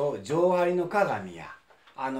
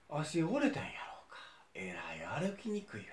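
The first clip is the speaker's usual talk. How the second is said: whispering; 9 dB quieter than usual. Acted. Muttering to himself, hurt.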